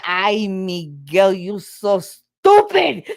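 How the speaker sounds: slow, loud Puerto Rican accent, imitated; exasperated mother in a hammed-up Puerto Rican accent; thick Puerto Rican accent. Puerto Rican mother scolds her son, thick accent mimicked. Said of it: "She's got a very thick accent".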